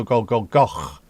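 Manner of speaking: "go, go, Goch" ending in a throaty ch; Welsh ch. Shown as a demonstration. Streamer showing chat the Welsh ch sound. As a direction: scrape the end of "Goch". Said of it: "is close enough to it".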